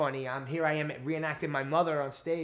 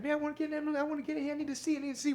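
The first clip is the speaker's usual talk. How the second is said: much higher whiny crowd voice; pushy diner; much faster than usual. Retold or imitated. Hungry crowd begging the host to get in, high whine. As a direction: whine like an impatient customer. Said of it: "But it was like".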